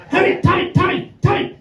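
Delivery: balcony women calling down to the singer, shrieking; women shouting. Retold or imitated. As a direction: shriek from the balcony to get his attention. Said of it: "they were shouting down".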